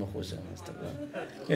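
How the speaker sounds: hushed, breathless whisper; whispered aside. Real, not acted. Rabbi slipping a private aside to the man on the phone, whispered. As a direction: drop to a secret whisper.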